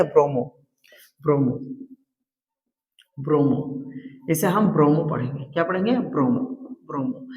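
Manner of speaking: ब्रोमो drilled low and falling; read as bromo. Shown as a demonstration. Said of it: "तो ब्रोमो पढ़ेंगे।".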